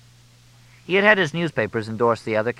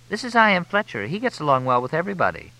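female voice; 9 semitones higher than usual. A narrator's reading. Freddie introduces Fletch.

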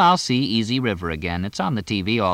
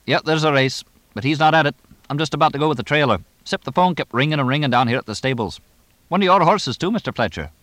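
put-on rapid, higher-pitched English accent; 7 semitones higher, 3 dB louder, faster than usual. A narrator's reading. Horse trainer rattling on by phone to the owner, fast English accent. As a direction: chatter along briskly in an English accent. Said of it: "sped along in his English accent".

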